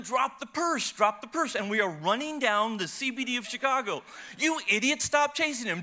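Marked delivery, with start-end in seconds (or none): 0.0-1.6 high, urgent mock yell; retold yelling. Husband chasing a purse snatcher, high mock yell. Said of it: "I'm yelling". 4.4-5.8 high, louder scolding mock yell; imitated yelling. Wife yelling at her husband mid-chase, high imitated yell. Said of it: "she's yelling at me".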